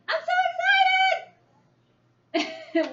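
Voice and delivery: loud, high excited yell; excited exclamation. Shown as a demonstration. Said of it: "what just happened to my voice?".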